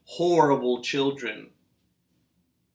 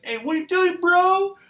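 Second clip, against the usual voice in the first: much higher, louder playful bro voice; teasing dad voice; 7 dB louder than usual. Retold or imitated. Dad teasing his son, goofy high and loud. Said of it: "I'll be like".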